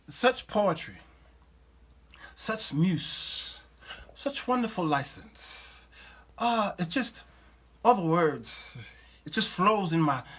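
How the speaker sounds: soft, mock-dreamy praise; mock admiration. Host sarcastically admiring a rapper's cursing rant, mock-swooning. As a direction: swoon quietly, laying on the sarcasm.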